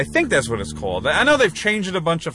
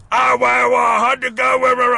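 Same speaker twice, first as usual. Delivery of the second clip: loud, high exaggerated British yokel; hammed-up shouted British voice; old-timey British accent; 9 semitones higher, 5 dB louder than usual. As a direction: overdo a thick old English accent. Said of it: "I don't think anybody talks like that anymore".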